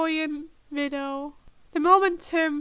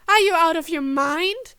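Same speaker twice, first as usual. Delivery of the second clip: loud furious shout; 8 dB louder than usual. A narrator's reading. Tim yelling at the agent, shouting. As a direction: yell it at the agent.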